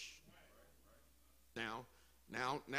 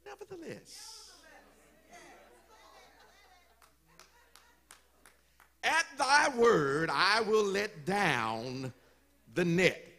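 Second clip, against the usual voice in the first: solemn surrender; 2 semitones higher, slower than usual. Acted. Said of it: "Here it is".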